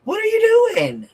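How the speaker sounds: very high squealed "What are you doing?" with rising "you"; mock squeal. Shown as a demonstration. Streamer mocks someone in a very high squeal. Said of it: "same words, first take".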